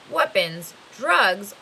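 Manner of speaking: plain reading voice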